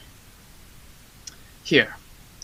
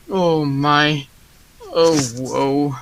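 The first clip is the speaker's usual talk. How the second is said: much softer, lower, defeated moan of woe; despairing sigh; 4 semitones lower, 7 dB quieter, much slower than usual. Acted. Baker picturing ruin by Drosselmeyer, a soft, defeated moan. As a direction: let it sink away in despair.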